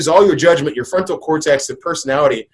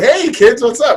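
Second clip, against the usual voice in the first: put-on higher, bright hello to the kids; cheerful dad greeting; 8 semitones higher, 4 dB louder than usual. Happier father greeting kids after work, higher and cheerful. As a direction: bounce in the door happy. Happy father coming home.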